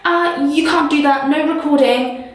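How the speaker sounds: high-pitched interrupting objection, imitated; piping up. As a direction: pipe up in mock officious protest. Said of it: "that's when the lady fucking pipes up".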